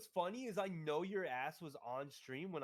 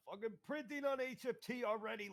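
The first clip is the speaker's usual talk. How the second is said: high-pitched elated outburst; real excitement at winning already; overexcited; 8 semitones higher than usual. Real, not acted. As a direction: crow about it, pitched way up. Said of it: "I'm getting too excited".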